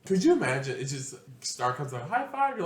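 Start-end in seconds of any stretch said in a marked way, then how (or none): 2.1-2.6 eager high five. Pretend. Star's drunk high five.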